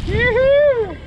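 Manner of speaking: high drawn-out yoo-hoo call; calling from far away. Real, not acted. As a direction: sing it out across the field. Calling dog from afar.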